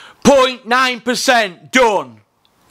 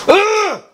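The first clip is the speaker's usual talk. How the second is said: a short, high groan of frustration; a high "ugh!" thrown out in disgust; exasperated high groan; 12 semitones higher, 3 dB louder than usual. Retold or imitated. Exasperated outburst at Hearn.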